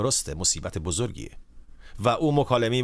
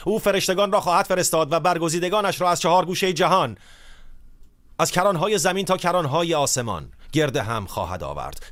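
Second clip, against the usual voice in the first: scripture reading; 6 semitones higher than usual. Acted. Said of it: "اینطور میگه".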